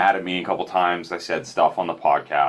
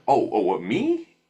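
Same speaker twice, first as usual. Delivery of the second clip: surprised; 3 semitones higher, slower than usual. Retold or imitated. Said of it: "I was like".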